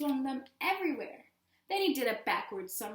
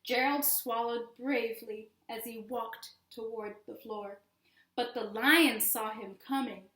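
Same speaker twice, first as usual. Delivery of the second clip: usual speaking voice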